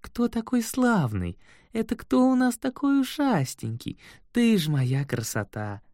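high, slow cooing voice; baby talk to pet. A narrator's reading. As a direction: coo at the kitten, trembling with tenderness. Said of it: "дрожащим от умиления голосом".

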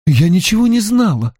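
high-pitched, stunned daughter; shocked. A narrator's reading. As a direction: voice jumps up in shock. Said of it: "потрясённо выдохнула Анна".

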